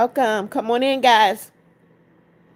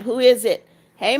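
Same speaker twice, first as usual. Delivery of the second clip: normal talking voice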